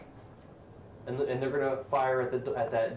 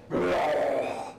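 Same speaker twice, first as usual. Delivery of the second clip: big, full-throated goblin roar; much louder goblin growl; 3 semitones higher, 3 dB louder than usual. Shown as a demonstration. Game master demonstrates the new loud goblin, big growl. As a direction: imitate a big goblin roaring "rrrr". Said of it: "that volume, much louder".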